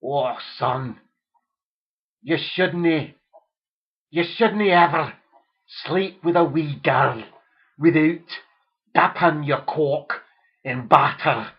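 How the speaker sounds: loud, slow fatherly Scots advice; hammed-up Scottish dad lecturing; mock Scottish father. Father advises his son in mock Scottish. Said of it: "that's how they speak up in Scotland".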